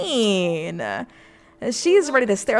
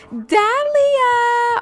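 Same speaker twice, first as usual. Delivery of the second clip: high, excited name call; thrilled greeting; excited squeal; 11 semitones higher than usual. Real, not acted. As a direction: squeal her name with joy.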